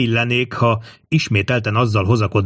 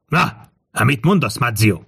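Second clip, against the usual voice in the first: ordinary delivery, nothing marked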